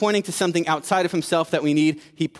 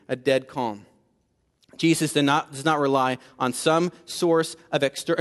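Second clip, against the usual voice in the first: usual speaking voice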